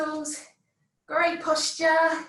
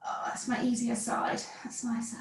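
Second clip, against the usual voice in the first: soft relieved whisper; 4 semitones lower, 7 dB quieter than usual. Real, not acted. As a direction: breathe it out under your breath. Relieved aside during exercise.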